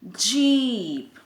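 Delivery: "जीप" with a long stretched ee; "जीप" as one long, slow blend; blended phonics reading. Shown as a demonstration. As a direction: draw out the vowel in "जीप", loud. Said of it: "इसके साथ में add हो जाता है".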